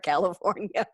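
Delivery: ordinary talk, nothing marked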